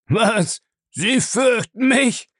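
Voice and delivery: very high, slow mock astonishment; amused surprise. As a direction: savour it with delighted disbelief.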